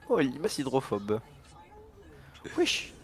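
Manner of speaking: ordinary talk, nothing marked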